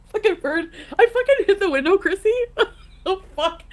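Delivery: laughing through the words; loud laughing retelling. Real, not acted. Laughing while telling bird story.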